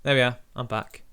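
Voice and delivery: normal talking voice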